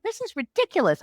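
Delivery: high-pitched indignant outburst; retold indignation. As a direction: protest in mock outrage. Retold angry outburst at father-in-law.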